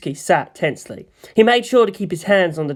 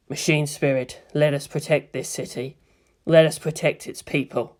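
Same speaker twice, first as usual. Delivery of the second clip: murmured invocation; 4 dB quieter than usual. Acted. Pilot softly prays to his machine before launch. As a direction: chant to the machine inside.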